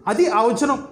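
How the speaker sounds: ordinary talk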